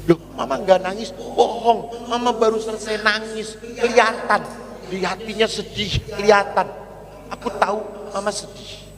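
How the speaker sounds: put-on a small boy begging his mother not to be sad. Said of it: "dia teriak". (suara anak kecil yang tinggi, membujuk mamanya)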